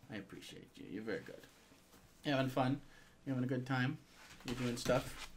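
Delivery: very soft, low murmur to a dog; tender pet talk. Real, not acted. Owner checks the dog's eyes and thanks him, tender murmur. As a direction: fuss over a pet in a hushed voice. Fussing over his dog up close.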